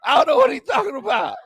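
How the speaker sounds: high, loud, helpless with laughter; real breathless hilarity; cracking up. Real, not acted. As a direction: exclaim it between big laughs. Still cracking up, repeats himself.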